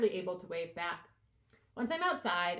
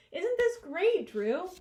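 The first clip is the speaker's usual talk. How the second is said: gushing, voice leaping much higher and louder; excited; 10 semitones higher, 7 dB louder, slower than usual. Acted. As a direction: cheer it out like the best news of the day. Librarian thrilled by Audrey's help.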